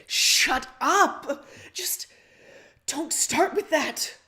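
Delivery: loud, dark angry snarl. Acted. Rose snarling at her friend over the phone, loud anger. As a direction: snap at him, loud and dark. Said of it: "Rose snarls through the phone at you".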